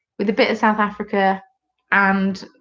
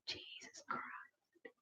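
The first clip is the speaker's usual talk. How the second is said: hushed whisper; awed whisper under breath; 31 dB quieter, much slower than usual. Real, not acted. Reading a comment in awe.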